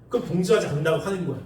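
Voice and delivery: ordinary talk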